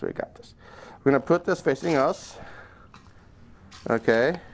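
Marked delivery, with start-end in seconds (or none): 0.0-0.5 fading to a whispered pep talk. Real, not acted. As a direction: breathe it out to yourself. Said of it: "pep talk fading to whisper".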